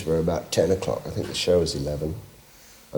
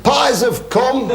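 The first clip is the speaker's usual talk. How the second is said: much higher, slow, loud drawn-out call; announcing shout; 5 dB louder, much slower than usual. Retold or imitated. Club secretary calls the audience to the pies, loud and drawn out. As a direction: bellow it across the club like a concert secretary. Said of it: "he said".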